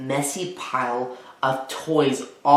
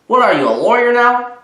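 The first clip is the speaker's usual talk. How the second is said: louder, higher incredulous mother; mock-accuse him of lawyering; teasing mother; 8 semitones higher, 6 dB louder than usual. Mother teases Spencer for bargaining, louder and higher.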